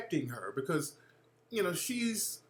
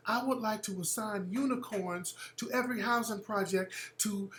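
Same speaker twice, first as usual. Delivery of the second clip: Marianne Williamson; 6 semitones higher, 4 dB quieter than usual. Retold or imitated.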